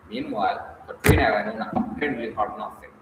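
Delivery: plain reading voice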